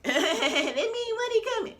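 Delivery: high, slow, laughing squeal. Real, not acted. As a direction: laugh it out in delight. Repeats the phrase, then laughs.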